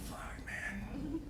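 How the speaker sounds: breathy whispered fuck man; whispered curse. Retold or imitated. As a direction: mutter it under your breath in dismay. Whispered aside about son.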